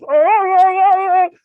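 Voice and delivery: Tusken Raider cry. Retold or imitated. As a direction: chant like a hyped Tusken Raider. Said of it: "we were fucking Tusken Raiders from Star Wars".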